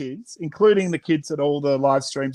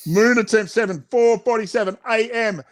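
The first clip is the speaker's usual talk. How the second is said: announcer log; 5 semitones higher than usual. Acted. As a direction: announce the attempt like a space mission. Announcing attempt.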